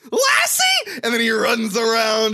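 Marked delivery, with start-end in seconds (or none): 0.1-1.0 put-on boy shouting for his dog; excited shout. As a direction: yell Lassie like a delighted kid. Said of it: "he goes".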